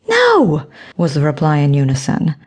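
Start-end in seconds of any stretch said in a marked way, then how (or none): 0.1-0.7 high shouted no; a group's emphatic high No; loud refusal. A narrator's reading. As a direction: shut the idea down at once. Everyone refuses in unison.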